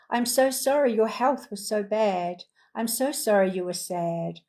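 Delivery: ordinary talk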